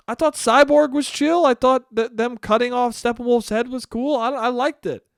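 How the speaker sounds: high-pitched flustered protest; bewildered, defensive. Acted. Acted defensive fan under attack.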